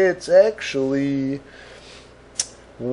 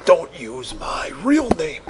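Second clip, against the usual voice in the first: slow, hushed warning; mock secretive whisper; 3 semitones higher than usual. Mocking secretive walkie-talkie user.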